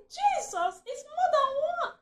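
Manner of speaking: high, loud startled cry; alarmed cry. Retold or imitated. Wife retelling seeing the whole herd, loud alarm. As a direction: yelp it as you bolt. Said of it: "I said".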